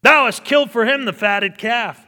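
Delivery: very loud, high, accusing; bitter elder brother. Acted. Elder brother accusing his father, loud and bitter. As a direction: throw the harlots in his face. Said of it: "the bitterness in the elder brother's voice".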